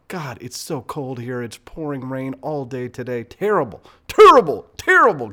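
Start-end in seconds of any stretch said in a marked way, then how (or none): 3.2-5.3 building to a loud, much higher wail; growing groan of misery. Real, not acted. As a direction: moan about the awful weather. Complaining about cold rain.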